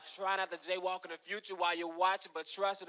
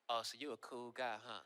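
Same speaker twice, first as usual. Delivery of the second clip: much lower, quieter sarcastic cop voice; 8 semitones lower, 10 dB quieter than usual. Retold or imitated. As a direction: sneer it like a put-out cop. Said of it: "the next thing he said was".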